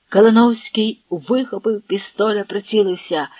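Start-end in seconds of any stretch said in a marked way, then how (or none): none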